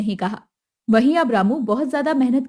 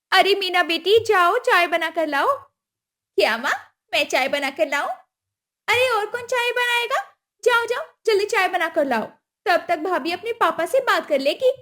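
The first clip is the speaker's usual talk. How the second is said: higher, pushy demand; demanding mother-in-law; 7 semitones higher than usual. Acted. Kusum demanding the dowry at the wedding, high and pushy. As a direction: ask for the money like it's owed.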